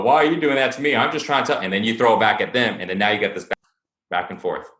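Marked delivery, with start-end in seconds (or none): 0.0-1.6 defensive retort. Pretend.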